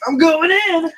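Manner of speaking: loud, high mock battle cry; gleeful dare. Reacting to the warning sign, loud mock bravado. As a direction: charge in like a fool who ignores the warning.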